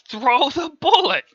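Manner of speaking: high, loud, slow angry order; mad yell. Acted. Roy to Peter who holds the bullet, mad yell. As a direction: holler it in a temper. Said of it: "Roy got mad".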